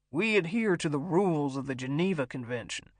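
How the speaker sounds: self-righteous pronouncement; pompous captor. A narrator's reading. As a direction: lay down the rules like a commander. Captor lectures his frightened hostage.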